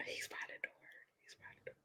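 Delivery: unvoiced hush so the child won't hear; whisper. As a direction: breathe it out without voice. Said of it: "tooth fairy is like".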